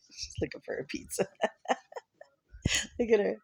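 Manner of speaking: breathy laughing through words. Real, not acted. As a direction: laugh while watching the dog. Laughing at dog.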